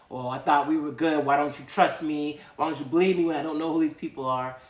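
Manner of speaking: arguing lover who feels accused; indignant pleading. Acted. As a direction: push back hard, wounded and defensive. Acted lovers' quarrel, Madeleine's side.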